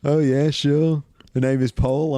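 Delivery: high, loud Beatle impression; [name]. Co-host introducing himself as a Martian Beatle, mock [name] accent. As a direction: put on a Liverpool Beatle voice. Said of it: "I have a perfect impression of him".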